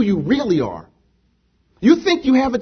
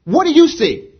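raised, louder challenge to the congregation; forceful question; 5 semitones higher, 6 dB louder than usual. Real, not acted. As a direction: drive the question home.

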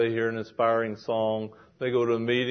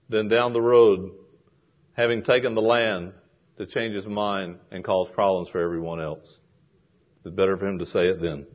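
usual speaking voice

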